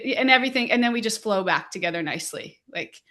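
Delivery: ordinary talk, nothing marked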